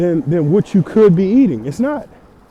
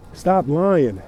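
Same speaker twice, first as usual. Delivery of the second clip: usual speaking voice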